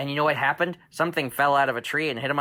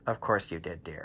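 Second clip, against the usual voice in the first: fond reply; 9 semitones lower, 8 dB quieter than usual. Acted. Mom answers fondly.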